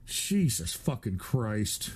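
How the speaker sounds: low, soft, slow, weary disbelief; exasperated groan. Real, not acted. Streamer fed up with the donations, groaning low and slow. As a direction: drag it out like a long-suffering sigh.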